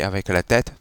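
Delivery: normal talking voice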